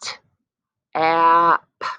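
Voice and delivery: slow blending repeat; slow sliding sounds again. Shown as a demonstration. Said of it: "Try it one more time".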